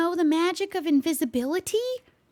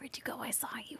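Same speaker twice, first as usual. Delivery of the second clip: whispered under breath; 3 semitones lower, 18 dB quieter, faster than usual. Real, not acted. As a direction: whisper like a seeker. Hunting a hidden player.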